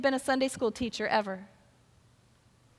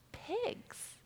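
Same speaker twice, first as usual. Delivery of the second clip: breathy, high whisper of disgust; a hushed, grossed-out pigs; 10 semitones higher, 13 dB quieter than usual. Acted. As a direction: hush it like it's gross. Disgusted aside about pigs.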